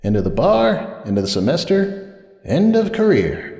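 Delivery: rising bitter self-mockery, slowing on the last; bitter lament. A narrator's reading. Sunburst's bitter self-pity.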